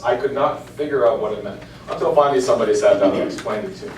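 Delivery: normal talking voice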